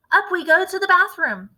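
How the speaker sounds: eager cry. A narrator's reading. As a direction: hurry him along. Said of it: "cried Mister Killy Cranky".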